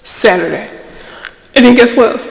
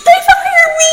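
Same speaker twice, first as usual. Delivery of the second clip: shouted punchline. Real, not acted. Vlogger shouting that they fired her. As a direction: blurt it out like a wail.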